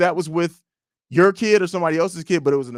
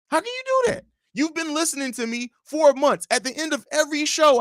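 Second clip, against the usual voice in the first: exasperated; 11 semitones higher than usual. Real, not acted. Host exasperated at misspelling.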